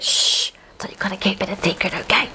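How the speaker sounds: soft unvoiced secret whisper. Real, not acted. Child swearing viewers to secrecy, a hushed whisper. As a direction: hush and share a secret. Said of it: "That's our secret".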